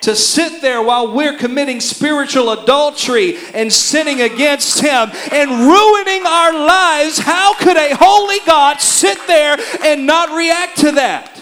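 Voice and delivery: high, loud, rising sermon cry; full-force preaching. Acted. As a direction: preach it out, building to a shout.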